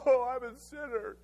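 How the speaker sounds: slowed, deflated mock confession; sheepish, a touch hammed-up self-pity; crestfallen sinner. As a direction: hang your head as you admit it. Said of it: "And I would go".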